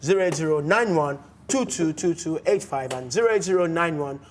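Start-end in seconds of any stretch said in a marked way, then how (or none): none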